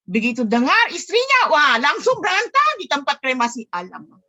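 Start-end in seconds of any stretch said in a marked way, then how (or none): none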